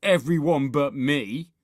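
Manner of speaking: Horrid Henry moaning. A narrator's reading. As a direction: complain loudly at being left out. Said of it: "moaned Horrid Henry".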